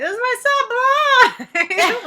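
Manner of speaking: high, loud, hammed-up wail; mock wail. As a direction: play up the outrage with a fake cry. Mock retort to creepy men.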